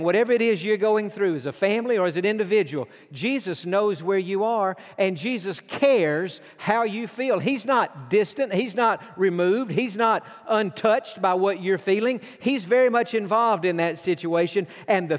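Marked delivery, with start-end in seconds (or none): none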